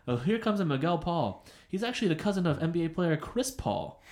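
a touch of arena announcer in a retold quote; announcer impression. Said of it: "the announcers would always say".

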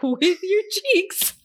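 laughing through the words, high and slow. Real, not acted. Cohost repeating the joke to listeners, laughing. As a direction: giggle it out.

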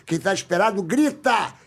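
high hype-man call to the crowd; catchphrase call. Shown as a demonstration. Performs his show's catchphrase title.

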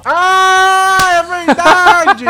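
high, loud, stretched realisation; surprised laugh. Real, not acted. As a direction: catch on with a laughing gasp. Alarmed by Alexa listening.

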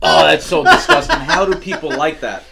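loud, laughing disgust. Real, not acted. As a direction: groan it out while laughing. Disgusted laugh after a shot.

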